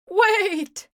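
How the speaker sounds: high loud cry. A narrator's reading. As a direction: cry out in panic. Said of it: "cried Sheep".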